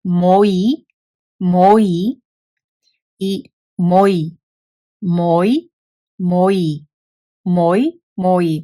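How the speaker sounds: loud listening drill; repeated for listening. Shown as a demonstration. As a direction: repeat "moi" for the learner to hear. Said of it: "So try to hear it".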